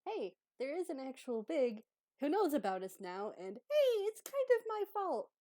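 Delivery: high, loud, mock-cheery confession; Abby owning up. Abby admits to Croak it's her fault, high and loud. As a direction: build to a high, over-bright admission. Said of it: "she also covers the fact that".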